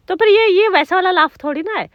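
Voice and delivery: ordinary talk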